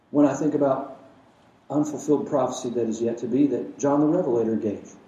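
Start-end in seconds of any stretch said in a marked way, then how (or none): none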